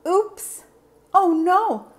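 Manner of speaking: high, loud sudden alarm; child watching a friend fall; alarmed cry. Acted. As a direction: gasp it out as he slips. Carlos falls from tree.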